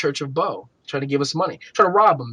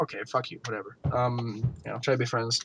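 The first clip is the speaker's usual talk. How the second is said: muttered dismissal; 4 semitones lower, 9 dB quieter than usual. Retold or imitated. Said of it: "And I'm like".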